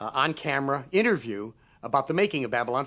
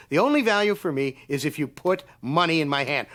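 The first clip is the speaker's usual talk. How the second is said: ordinary talk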